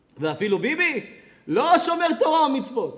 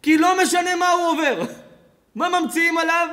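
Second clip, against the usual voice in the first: ordinary delivery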